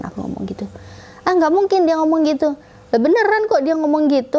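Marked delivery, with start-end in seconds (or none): 1.3-2.5 high-pitched, dismissive disbelief; incredulous retort, pitched up; retold disbelief. Retold sister disbelieves her claim. 2.9-4.4 high, protesting comeback; earnest protest, acted out; retold insistence. Retold insistence against disbelief.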